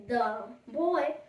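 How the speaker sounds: ordinary delivery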